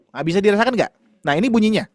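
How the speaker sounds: ordinary talk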